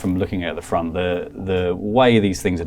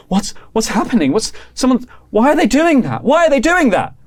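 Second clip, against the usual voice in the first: much higher and louder, climbing alarm; frantic panic; 5 dB louder than usual. Acted. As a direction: Charlotte begging for answers. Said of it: "she's like".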